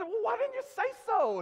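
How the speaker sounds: high, loud mock exasperation; exasperated. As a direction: cry it out in comic disbelief. Acted exasperated reply to Scottish friend.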